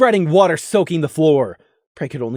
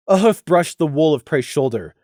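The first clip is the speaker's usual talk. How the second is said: usual speaking voice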